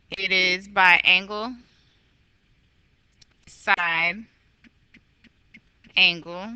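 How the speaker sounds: word by word with long pauses; reading slowly. Real, not acted. Teacher reading out a theorem name for delayed listeners, slowly. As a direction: leave a long gap between each term. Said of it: "I have to read slowly".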